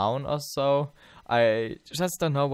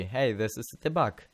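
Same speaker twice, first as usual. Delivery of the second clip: ordinary delivery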